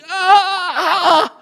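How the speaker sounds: high piercing scream of terror; terrified scream. Retold or imitated. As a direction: cry out in sheer terror. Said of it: "he is literally just going".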